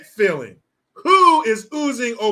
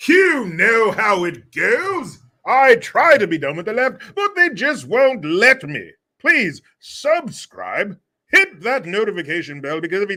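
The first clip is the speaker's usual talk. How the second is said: butler voice; 3 semitones higher, 6 dB louder than usual. Shown as a demonstration. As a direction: announce it like a pompous butler. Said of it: "Send it off with your butler voice".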